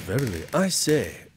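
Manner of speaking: guessing how Guy Fawkes talked, mock olde English; old English accent. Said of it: "What do you think he talked like?".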